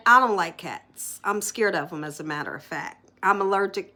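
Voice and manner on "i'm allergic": ordinary delivery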